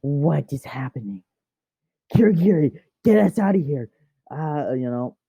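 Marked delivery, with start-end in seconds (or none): 0.0-1.2 whispered, bewildered question; confused whisper. Retold or imitated. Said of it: "he's like". 2.1-3.9 higher, louder plea to escape; panicked villain calling his ally; urgent call. Retold or imitated. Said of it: "he's like".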